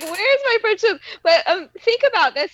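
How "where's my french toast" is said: quoting him through laughter; mock his whine while laughing; laughing imitation. Wife imitating her husband's whine, laughing. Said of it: "he was like".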